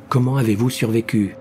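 ordinary delivery

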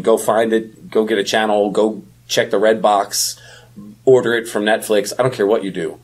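normal talking voice

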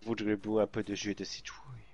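posh hushed whisper; snobby whisper. Pretend. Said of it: "arrête d'essayer de faire la snob". (murmure snob)

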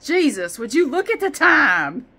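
loud startled exclamation; voicing the clock figure. Clock figure shocked at the time, loud exclamation. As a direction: shout it like a shocked man. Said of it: "He's not actually looking at the time".

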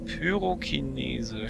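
ordinary talk, nothing marked

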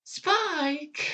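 squeaky high cartoon pony calling out; Twilight impression. Vlogger retrying Twilight for fans, high call. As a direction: call the name up high and far. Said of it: "let me try again with Twilight".

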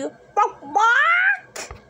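high, rising chicken clucks; mock chicken squawk.

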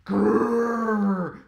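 loud growling bear. A narrator's reading. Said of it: "growled the bear".

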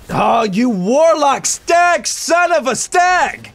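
loud, high, drawn-out cursing; exasperated outburst. Real, not acted. Gamer cursing the warlock who got him, exasperated shout. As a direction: wail the insult, stretching each word.

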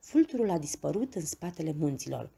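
ordinary delivery, nothing marked

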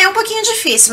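ordinary talk, nothing marked

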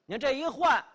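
usual speaking voice

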